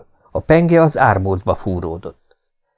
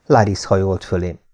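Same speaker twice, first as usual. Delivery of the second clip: plain reading voice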